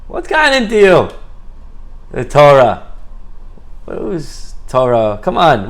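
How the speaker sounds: mock parental telling-off, punching each complaint; scolding father. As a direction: lecture a grown son who won't settle down. Father scolds son for wanting Torah.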